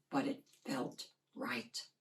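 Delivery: hushed, breathless close; whispered ending. A narrator's reading. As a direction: let it fade into a whisper. Quiet ending of narration.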